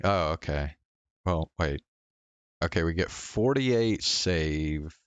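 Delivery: ordinary talk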